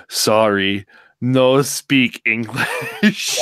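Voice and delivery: slow, loud put-on broken English as Nakamura; smirking slow mock broken English. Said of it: "leans over and says".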